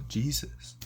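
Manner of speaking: usual speaking voice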